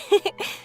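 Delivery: short, amused laugh; little giggle. Acted. Traveler unsure of the Aranara impression, little giggle. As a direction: giggle after your own impression.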